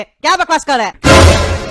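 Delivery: slow angry outburst. Acted. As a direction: hammer the question at the neighbours. Angry father repeats louder. (धीमा, ज़ोरदार गुस्से वाला सवाल)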